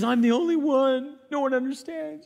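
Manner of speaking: high, whiny self-pitying wail; pity party. Retold or imitated. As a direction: sulk like a sorry-for-himself child. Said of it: "he's having a pity party".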